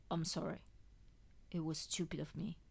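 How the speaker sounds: soft, whispered apology. Acted. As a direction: breathe it out, ashamed. Contrite apology, weak patient.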